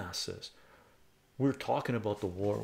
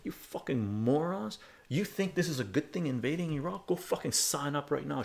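higher, heated telling-off; last angry words to the pub yahoos, raised and higher; retold angry rant; 6 semitones higher than usual. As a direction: lash out at them, voice up high. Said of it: "I said".

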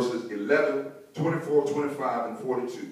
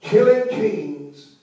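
loud, high, very slow proclamation; title announcement; 8 semitones higher, 6 dB louder, much slower than usual. Real, not acted. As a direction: ring out the title word by word. Repeats the sermon title.

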